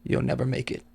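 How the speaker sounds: soft, unruffled quiet threat; dead calm. Retold or imitated. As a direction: imitate a man calmly warning, well below normal volume. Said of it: "in the calmest voice ever".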